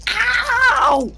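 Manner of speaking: loud high angry cry; angry yelp. Acted. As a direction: yelp it out in anger. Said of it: "I swear angrily".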